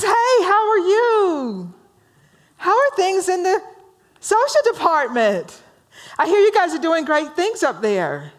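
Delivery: gushing romantic. Acted. Joe the romantic greets Bob at the mailroom, gushing. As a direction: sing out a hammed-up, high hello. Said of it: "says to Joe, the romantic".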